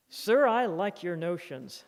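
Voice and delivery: hearty call. Retold or imitated. Passing railroad worker hails Thoreau, loud and hearty. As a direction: hail him like a passing workman. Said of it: "said a passing railroad worker".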